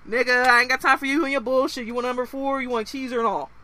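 loud rude cashier, mock insult greeting; rude inner-city cashier. Inner city kid working the window, mock rude cashier. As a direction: sneer the order at the customer. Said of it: "if they got any of these fucking inner city kids".

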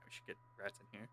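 whispered aside. Real, not acted. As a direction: whisper it as a joke. Muttered aside.

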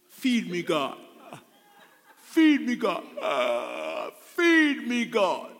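hammed-up begging to be fed; mock pleading. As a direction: plead like a helpless, demanding churchgoer. Acted passive congregant begging God.

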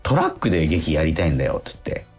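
ordinary delivery

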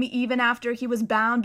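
plain reading voice

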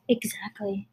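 whispering; breathy whispered agreement. Real, not acted.

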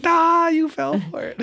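put-on squeaky gloating kid voice; high taunting kid. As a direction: tease them in a high kid voice. Kid gloating over the prank.

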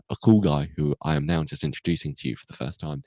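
ordinary talk, nothing marked

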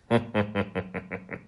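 menacing ho-ho-ho; tiger's chuckle. A narrator's reading. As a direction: chuckle slowly to yourself as the tiger. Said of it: "看看狐狸笑了".

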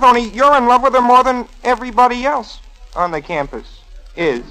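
ordinary talk

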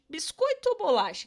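put-on teasing the class with the old debate; playful question to the class. Shown as a demonstration. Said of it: "O que que vocês acham".

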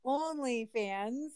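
"OnlyFans" drawn out carefully, word by word; deliberately slow. Real, not acted. Speaker who always reverses the name, saying "OnlyFans" slowly. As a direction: say "OnlyFans" slowly to avoid mixing it up. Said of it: "I have to say it slower".

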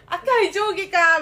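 loud, high, slow and hyped; drawn-out hammed-up excitement; hyped mock quote. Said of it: "みたいな".

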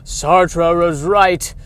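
very slow, heavy pronouncement; slow dramatic declaration. Acted. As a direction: drag each word out like a doom prophet.

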